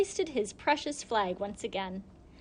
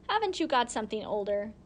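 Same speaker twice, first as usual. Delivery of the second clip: usual speaking voice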